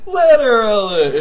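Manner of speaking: very high, loud and drawn out; laughing squeal. Real, not acted. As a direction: squeal it through helpless laughter. Cracking up over the bit.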